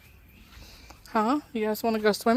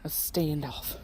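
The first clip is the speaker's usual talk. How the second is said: low, soft murmur; hushed low aside; 5 semitones lower, 11 dB quieter, slower than usual. Real, not acted. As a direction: comment quietly so as not to disturb. Watching animals face off.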